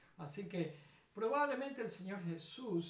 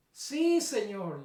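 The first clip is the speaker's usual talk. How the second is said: eager shout; 2 semitones higher, 12 dB louder, much slower than usual. Acted. The blind men answer Jesús, a loud eager shout. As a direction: blurt it out full of faith. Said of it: "le dijeron".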